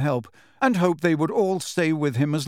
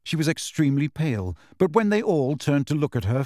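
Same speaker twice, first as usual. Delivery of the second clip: plain reading voice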